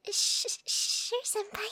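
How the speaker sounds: nervous, stammered whisper; stuttering whisper. Acted. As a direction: stammer it out under your breath. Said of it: "I mean".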